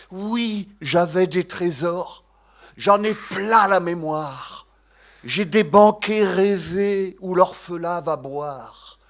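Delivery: slow, declaimed verse in Northern French accent. Shown as a demonstration. Reciting a Desbordes-Valmore poem, slow Northern French accent. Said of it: "je vais le dire avec l'accent du Nord".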